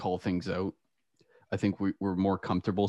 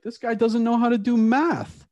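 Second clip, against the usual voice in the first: high, drawn-out public ridicule; mocking announcement; 13 semitones higher, 6 dB louder than usual. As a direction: imitate a teacher mocking someone before the class. Teacher mocks student before class.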